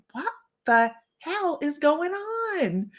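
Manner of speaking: high, slow, drawn-out bafflement; baffled. Retold or imitated. Quoting her confusion over the umbrella notes, slow and baffled. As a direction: stretch out the confusion. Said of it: "And I'm like".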